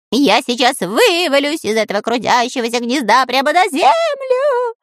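high whining voice rising very high. A narrator's reading. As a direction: whimper in fear. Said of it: "продолжал скулить Дырка".

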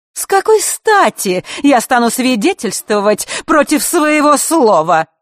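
high, loud indignant question; scornful refusal. A narrator's reading. Elena refuses to testify against her word, with scorn. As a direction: refuse loudly, stretching the last words.

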